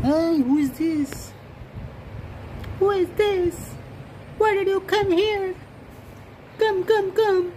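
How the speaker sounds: very high, slow, eerie voice; strange unknown voice. Pretend. Owner scaring his cat with a strange high voice. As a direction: spook the cat with a weird squeaky voice. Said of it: "He is scared with my voice, unknown voice".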